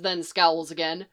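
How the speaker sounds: ordinary delivery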